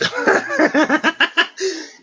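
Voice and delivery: sudden high squeal of a laugh; high burst of laughter. Real, not acted. Laughing at the tarot card's bird, high laugh.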